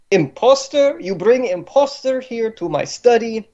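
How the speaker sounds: put-on affronted Slavic-accented grandee. Boss berates underlings over imposter, outraged Slavic accent. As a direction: rant with rising disbelief in a Slavic accent. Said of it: "pretty good Slavic ac-, accent".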